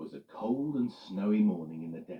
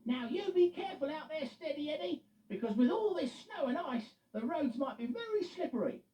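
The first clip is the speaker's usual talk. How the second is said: high, caring warning voice; Loretta. Acted. Loretta warning Eddie before he leaves, caring. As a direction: send him off with care.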